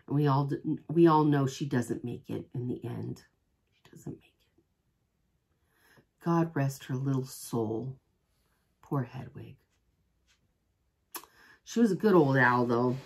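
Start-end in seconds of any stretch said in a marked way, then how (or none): 0.1-4.4 soft unvoiced grieving whisper; hushed whisper. Real, not acted. Seller mourning Hedwig to viewers, whispered. 6.2-9.6 soft mourning. Real, not acted.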